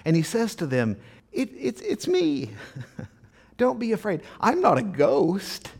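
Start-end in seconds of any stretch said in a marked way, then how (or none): 1.3-5.7 high-pitched, halting reassurance to frightened disciples; calm their fright in a raised voice; reassuring Jesus. Retold or imitated. Jesus to terrified disciples, high-pitched reassurance. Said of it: "they're, they're terrified".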